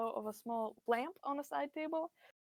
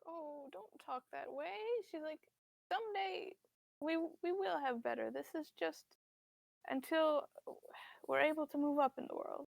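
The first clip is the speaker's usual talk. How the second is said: soft, higher voice of a tender mother; frail sympathetic mother; 4 semitones higher, 4 dB quieter than usual. Acted. Frail mother consoling her struggling son, soft and high. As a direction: comfort him softly.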